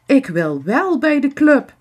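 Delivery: luid, hoog kinderprotest; protesterend. A narrator's reading. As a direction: protesteer fel tegen de anderen. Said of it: "protesteert Wietske".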